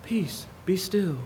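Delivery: soft, slow and gentle; hushed slow calming words; nice and quiet. Shown as a demonstration. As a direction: read it softly like a lullaby. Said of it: "You probably read it nice and quiet".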